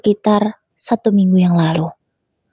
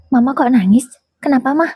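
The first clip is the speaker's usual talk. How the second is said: higher, lightly voiced young boy, worried; a small son asking his mother why she cries; child's voice; 5 semitones higher than usual. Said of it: "Adam masuk dan mengagetkanku".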